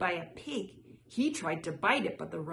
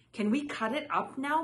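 put-on higher, eager child's question; hungrily; 6 semitones higher, 3 dB louder than usual. A narrator's reading. Ming asks Mama to cut the rice cake, hungrily. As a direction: ask it hungrily, pitched up. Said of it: "Ming asked hungrily".

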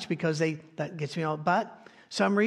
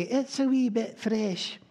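light Scottish lilt, slow and a bit higher; mock Scottish accent; 5 semitones higher, slower than usual. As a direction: say it like a Scot understating the cold. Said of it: "As the Scots would say".

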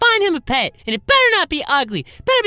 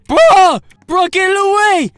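loud, frightened cry; panicked yell; 6 semitones higher, 8 dB louder than usual. Acted. Boy scared by the sniffing dog, yelling in panic. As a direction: shout to get it away.

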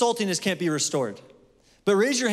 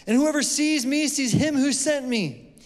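high, raised declaiming voice; crying out; 7 semitones higher than usual. A narrator's reading. Preacher reading Jesus's words to the congregation, crying out. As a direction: proclaim it high, as a cry to the crowd. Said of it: "Jesus cried out and said".

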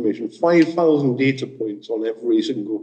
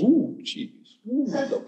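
high, breathy-sounding cheese-loving Wallace coo, softer; Wallace impression; 11 semitones higher, 6 dB quieter than usual. Talk-giver imitates Wallace savouring cheese, high cooing. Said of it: "Wallace goes".

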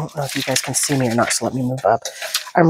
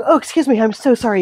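usual speaking voice

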